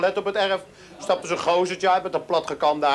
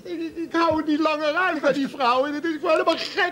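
higher, desperate whine; whiny husband; 7 semitones higher than usual. Acted. Man begs his friend Yves for help to get rid of his wife, higher whine. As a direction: plead like a henpecked husband at the end of his rope.

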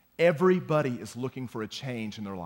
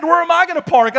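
loud, higher mock yell; a drunk neighbor yelling about parking; 13 dB louder than usual. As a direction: holler the question. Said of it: "we started just kinda yelling back and forth".

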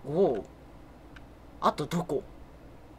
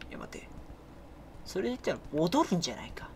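hushed whispered planning; 3 semitones lower, 10 dB quieter than usual. Real, not acted. Player hiding, whispering a plan. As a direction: whisper so the monster won't hear.

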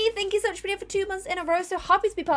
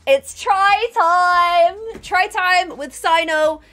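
loud, long-drawn segment announcement; hyped announcer call; 2 semitones higher, 7 dB louder, much slower than usual. Pretend. Streamer launching the workout segment, loud hyped call. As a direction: stretch it out like a show host.

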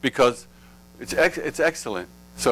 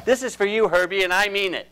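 put-on higher-pitched kid taunt; mock childish declaration; 9 semitones higher than usual. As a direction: play a kid making a vow, pitched up. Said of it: "when we were little kids and we used to say".

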